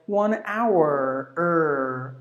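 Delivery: "hour" as OW-errr, long R; exaggerated American R ending "hour"; American pronunciation. Shown as a demonstration. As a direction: slowly draw out the R in "hour". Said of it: "there is another letter R".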